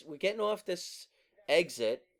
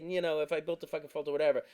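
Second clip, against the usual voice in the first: normal talking voice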